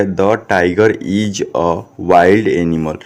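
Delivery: very slow, word-by-word reading; model reading. Shown as a demonstration. Teacher showing students how to read English, slow word-by-word. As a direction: read it out slowly, stretching each word. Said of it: "तो कैसे पढ़िएगा".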